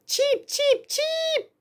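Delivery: tiny high chirps; cartoon bird voice. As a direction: chirp like baby birds. Chicks chirping.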